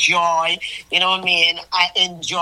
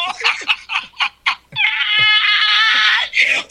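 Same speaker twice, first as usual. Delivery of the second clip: high squealing laugh; 12 semitones higher than usual. Real, not acted. Delighted by the uno.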